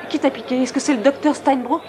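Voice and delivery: worried, insistent demand; urgent interrogation. Acted. Urgent questioning about who did it.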